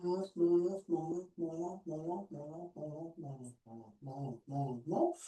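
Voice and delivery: slowly fading cue that guides the descent. Shown as a demonstration. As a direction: let the repeats melt away to nothing. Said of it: "on descend lentement".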